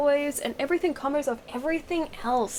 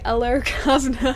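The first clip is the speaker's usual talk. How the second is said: mock British hello; 2 semitones higher, 3 dB louder than usual. Shown as a demonstration. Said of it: "that's when you say".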